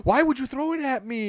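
high-pitched fake indignation; mock whiny. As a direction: play the wounded innocent.

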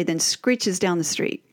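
normal talking voice

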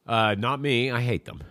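ordinary talk, nothing marked

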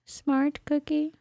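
sing-song. Acted. Repeating compliment playfully.

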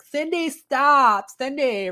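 high, drawn-out mock yell; friends calling out. Drunk woman's friends call her off the lift, high mock yell. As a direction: imitate a panicked friend calling her back, loud and high. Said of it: "her friends are like".

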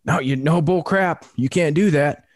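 high, scolding holler; imitated angry objection. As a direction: mimic the irate neighbour shouting back. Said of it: "she was mother effing me up and down".

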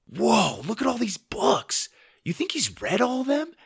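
put-on high, excited kid voice; high-pitched wonder; amazed boy. A narrator's reading. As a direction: marvel like an awestruck boy. Boy amazed by huge library.

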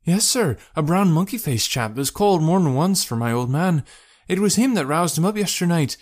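high, voluble working woman; worried wife, insistent; boatman's wife, uneasy. Acted. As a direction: pour out suspicions in a high voice.

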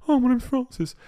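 mock posh train enthusiast, high and bright; imitating Francis. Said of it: "He goes".

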